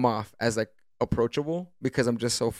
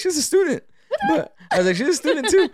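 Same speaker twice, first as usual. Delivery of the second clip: high, laughing disbelief; surprised. Retold or imitated. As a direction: squeak it out in shock. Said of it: "I'm like".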